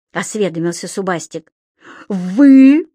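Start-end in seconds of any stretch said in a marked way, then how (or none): 2.1-2.9 loud, high, angry correction; roaring. A narrator's reading. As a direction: bellow the word at the pupil. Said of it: "снова рявкнул Стукин Крик".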